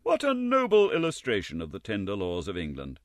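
ordinary delivery

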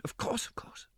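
whispered promise. Acted. Girl promises secrecy to her friend, whispered. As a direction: breathe it as a secret. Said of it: "whispered promise of secrecy".

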